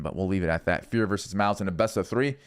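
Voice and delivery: ordinary talk, nothing marked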